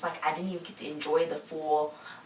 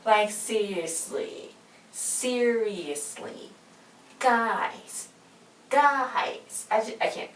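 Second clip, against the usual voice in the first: slow, drawn-out, higher-pitched appeal; exasperated disbelief; 5 semitones higher, much slower than usual. Real, not acted. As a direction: stretch each word out in disbelief. Pleading with viewers in exasperation.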